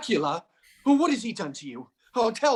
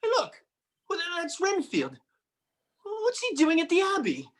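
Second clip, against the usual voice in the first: higher, alarmed questions; startled surprise; 10 semitones higher than usual. Acted. John startled to spot Renfield at the abbey. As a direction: catch sight of him and gasp it out.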